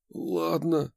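strained, breathed out; strained exhale. A narrator's reading. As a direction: squeeze it out on a sigh. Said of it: "сдавленно выдохнул он".

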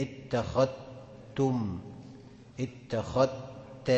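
اتخذتم very slowly, the dhal merged into the ta; idgham demo. Shown as a demonstration. Teacher modelling assimilation for the student. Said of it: "بتنطق بإدغام الذال في التاء".

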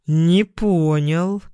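slow, drawn-out pretend outrage; feigned offence, drawn out for comic effect; mock indignation. A narrator's reading. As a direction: play up being affronted, stretching the words. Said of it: "наигранно возмутился он".

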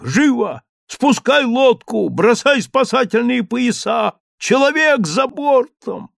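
high, loud, slow frantic orders; frenzied shouting. A narrator's reading. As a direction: rave like a captain in a storm. Raving man shouting rescue orders.

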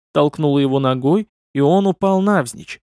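plain reading voice

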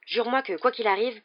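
hurried, pressing insistence; insistent, speeding up. A narrator's reading. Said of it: "en parlant de plus en plus vite".

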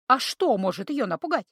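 usual speaking voice

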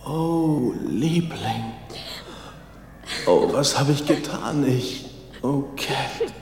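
low, soft, broken voice; grieving father. Acted. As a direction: comfort her while your own heart breaks. Father realizes he is dead.

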